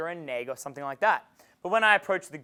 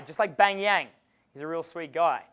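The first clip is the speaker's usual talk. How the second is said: usual speaking voice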